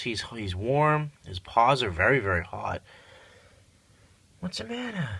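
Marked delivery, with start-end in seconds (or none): 4.4-5.1 high, soft coaxing voice to a cat; high cooing at the pet; pet talk. Real, not acted. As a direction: coax the cat gently, way up high. Asking the cat.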